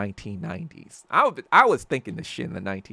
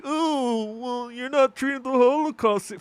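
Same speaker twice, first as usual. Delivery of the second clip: a high, sneering put-on voice; mocking Zionist critic; 10 semitones higher than usual. Streamer mocking critics in a high, sneering put-on voice. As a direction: mimic the critic's whiny objection.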